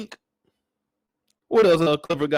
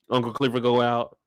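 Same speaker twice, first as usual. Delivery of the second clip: ordinary talk, nothing marked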